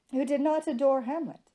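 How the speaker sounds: plain reading voice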